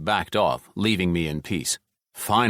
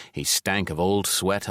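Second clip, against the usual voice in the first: ordinary delivery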